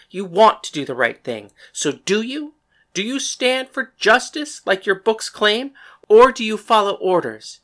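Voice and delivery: high, loud, angry challenge; yelling. A narrator's reading. Woman confronts cavalry captain after slapping him, angry yelling. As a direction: yell it in his face, demanding an answer. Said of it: "slapped him across the face and then yelled".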